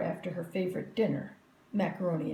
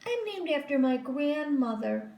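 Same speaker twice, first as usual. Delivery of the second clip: put-on high, superior little girl; smug girl; 6 semitones higher than usual. A narrator's reading. Victoria boasting to the class, high and smug. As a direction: lift your nose and boast.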